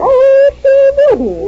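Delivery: high, sharp and offended; indignant disbelief. Acted. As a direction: bristle at the claim. Wife bristles at her friend's claim.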